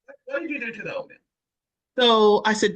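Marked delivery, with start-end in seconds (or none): 0.0-1.2 fast, annoyed questioning; mock angry coworkers. Coworkers confronting [name] about the oatmeal: fast irritated demand. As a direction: hurry the accusation, irritated. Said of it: "people used to get angry and said".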